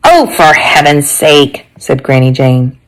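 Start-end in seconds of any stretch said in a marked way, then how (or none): 0.1-1.7 slow, drawn-out exasperation; exasperated grandmother. Acted. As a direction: draw it out with weary impatience.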